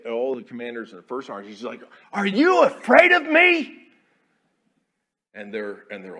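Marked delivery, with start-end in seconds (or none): 2.1-3.9 much higher, slow, loud enraged bellow; furious yell. Retold or imitated. Enraged commander yelling at his officers, loud bellow. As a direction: roar it at the room like a raging commander. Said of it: "he's yelling and he's cussing".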